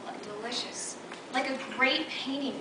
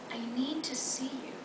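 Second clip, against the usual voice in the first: near-whispered earnest need; hushed, breath-only request; intimate plea; 7 dB quieter than usual. Acted. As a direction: whisper it softly to a lover. Bob's intimate plea, retold.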